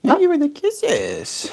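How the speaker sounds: high, slow cooing thanks. Real, not acted. Repeat to the bird.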